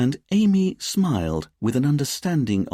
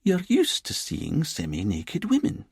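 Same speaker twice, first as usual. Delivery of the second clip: put-on lilting Edinburgh accent; 9 semitones higher than usual. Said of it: "in her lilting Edinburgh accent".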